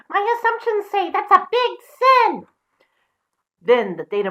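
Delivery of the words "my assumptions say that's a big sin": much higher, louder piggy squeak; high squeaky pig defying the wolf; little pig voice. Acted. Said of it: "I'm not very good at doing voices".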